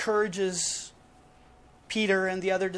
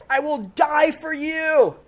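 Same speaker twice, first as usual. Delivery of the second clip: Peter's boast; 7 semitones higher, 7 dB louder than usual. Retold or imitated. Peter's boast voiced in a sermon.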